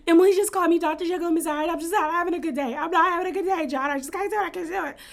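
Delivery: high, fast whiny wail, imitating Shannon; hammed-up high whimper of a woman at her limit; mock meltdown. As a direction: play her as a fragile, overwhelmed wreck for laughs. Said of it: "while Shannon's having this meltdown".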